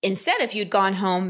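usual speaking voice

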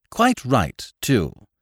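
high, emphatic agreement; hearty, emphatic endorsement. A narrator's reading. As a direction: agree with real force. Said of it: "said Whimsy emphatically".

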